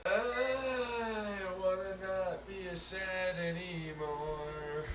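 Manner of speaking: high, very slow, mournful little voice; pitiful voice of a neglected food; sad little food voice. Pretend. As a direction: plead slowly like a lonely object.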